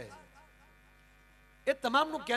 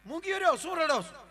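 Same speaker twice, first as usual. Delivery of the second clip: bride scolding; 8 semitones higher, 5 dB louder than usual. Acted. Bride scolds weeping relatives.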